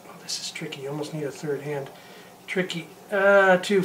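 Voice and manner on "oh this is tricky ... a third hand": whispered under the breath; muttered aside. Real, not acted. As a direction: mutter it to yourself, much softer. Muttering while filling the syringe.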